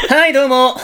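gespielter Schönstimmen-Podcaster. Pretend. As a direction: put on a would-be handsome-voiced host. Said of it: "じゃあ俺、イケボポッドキャスターやるわ".

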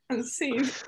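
ordinary talk

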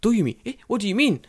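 mock baffled NHK man. NHK man confused by the joke bill, high baffled voice. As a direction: play him lost and flustered. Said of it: "And he's like".

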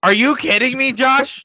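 high, loud disbelief; are you serious, cry out; incredulous outburst. Real, not acted. Disbelieving co-host over standings, loud incredulous.

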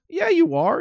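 high-pitched friendly needling; Ralphie's voice. Retold or imitated. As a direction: push back like a pushy buddy. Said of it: "He goes".

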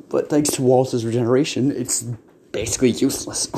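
normal talking voice

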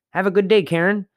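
high, bright sing-song greeting to a clerk; cheery goodbye. Shown as a demonstration. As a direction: chirp it out as you leave the store. Cheery greeting using clerk's name.

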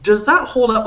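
high-pitched, doubtful challenge; skeptical questioning. Retold or imitated. Bystander doubting the bouncer's nightstick excuse, high and skeptical. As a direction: poke at his claim, pitch way up.

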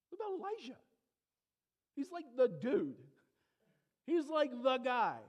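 exasperated disbelief; preacher flabbergasted at the Pharisees' forgetting; incredulous. Real, not acted. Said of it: "I'm just so mad".

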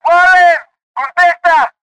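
desperate shouting. A narrator's reading. Narrator shouting into the telephone for Warren, desperate. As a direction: yell for an answer from a silent line. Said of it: "llamé y grité".

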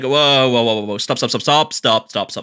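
rapid-fire repeated protest, retold; acted "hold on" interruption; mock alarmed halting. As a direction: rein in someone who is running away with an idea. Acted protest halting sister's gushing.

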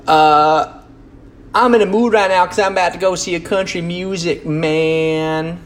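half-drop the Southern voice; fading country accent. Pretend. Vlogger easing out of his country voice, light twang. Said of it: "apologies for the country accent".

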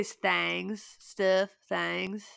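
slow Southern drawl on stuff and things, stuff as stif, things as thangs; Southern accent. Shown as a demonstration. Cohost requested Southern accent, gives a slow Southern drawl. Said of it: "You gotta say it in a Southern accent".

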